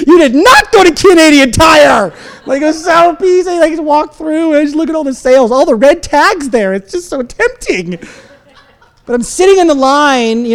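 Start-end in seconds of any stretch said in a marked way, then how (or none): 0.1-2.1 mock scolding shout, higher pitched; scolding wife. Wife catches husband with a store bag, mock scolding shout. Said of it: "She's like". 2.5-8.0 high-pitched, hammed-up whiny pleading; pleading excuse. Husband excuses his shopping to his wife, comic pleading.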